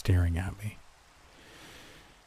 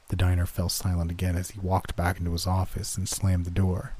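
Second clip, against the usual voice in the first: plain reading voice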